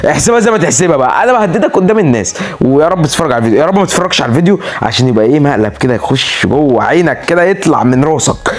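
ordinary talk, nothing marked

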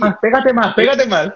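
imitating a cameraman; directing cameraman. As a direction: push them closer like the cameraman. Mimics cameraman directing them.

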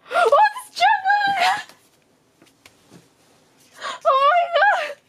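very high, loud squealing cry; fangirl scream. Real, not acted. Fan screaming on finding her idol's card. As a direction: scream it out in pure delight.

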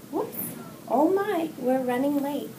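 plain reading voice

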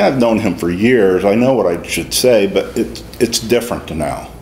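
normal talking voice

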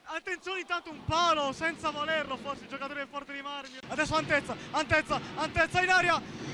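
usual speaking voice

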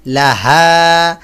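লাহা with the final া drawn out long; slow, held লাহা; elongated vowel. Shown as a demonstration. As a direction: stretch the last vowel of লাহা. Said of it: "এইখানে hyphen আছে, টান হবে".

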